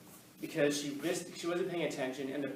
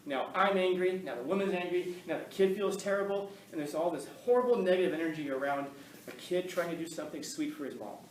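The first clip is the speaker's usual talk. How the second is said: normal talking voice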